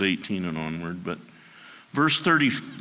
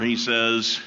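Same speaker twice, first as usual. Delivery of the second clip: ordinary delivery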